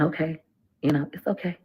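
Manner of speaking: calm him down like a worried partner; soothing. Retold or imitated. Soothing her husband in a story.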